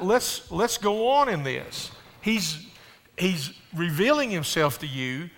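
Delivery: a pastor preaching hard about the cross; preaching at full force. Acted. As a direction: drive the point home to the congregation.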